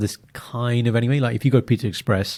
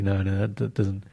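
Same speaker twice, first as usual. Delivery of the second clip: usual speaking voice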